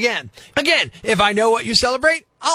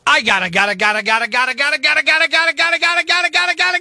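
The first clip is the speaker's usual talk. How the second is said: gotta chant rising in pitch to a shouted crazy man; ranting host losing it at the end of the rant; frenzied build; 8 semitones higher than usual. Acted. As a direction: wind yourself up faster and higher till you explode.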